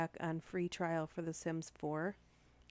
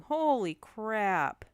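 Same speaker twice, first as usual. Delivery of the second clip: slow, drawn-out, much louder holy crap; stunned; 4 semitones higher, 9 dB louder, much slower than usual. Real, not acted. Streamer reacting to a viewer's fire story, slow loud shock. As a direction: let the shock hit you.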